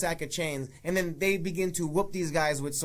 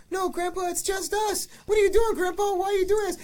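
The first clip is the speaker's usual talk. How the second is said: put-on high, frantic kid voices pleading; shrill mock panic at Grandpa; panicked kids; 12 semitones higher than usual.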